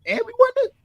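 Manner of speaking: a high whoop settling into giddy bragging; hammed-up locker-room cheer; mock whooping. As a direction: whoop it up like the cameras are off. Players celebrating once the cameras are out.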